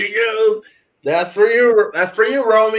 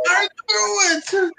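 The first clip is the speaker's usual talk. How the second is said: I knew it; 8 semitones higher, slower than usual. Real, not acted. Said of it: "guess confirmed, exclaiming".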